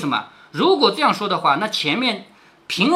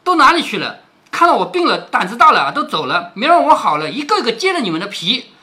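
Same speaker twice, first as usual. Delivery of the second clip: angry scolding; 4 dB louder than usual. Acted. Sick Qingwen berating the runaway maids, loud and angry. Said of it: "所以他又骂这个小丫头说".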